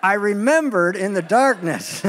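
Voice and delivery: slow, chuckling through the words; laughing retry. Shown as a demonstration. Preacher laughing at himself, trying the line again. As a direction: restart the line while laughing. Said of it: "Let me try that again".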